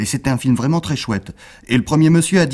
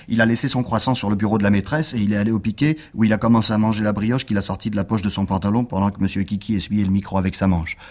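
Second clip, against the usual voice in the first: plain reading voice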